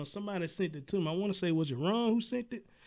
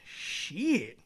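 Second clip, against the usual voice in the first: stretched, falling "sheee-it"; slow teasing drag on the word; drawn-out cuss. Retold or imitated. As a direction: say the word long, out of the blue. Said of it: "I just say it out of the blue. I be like".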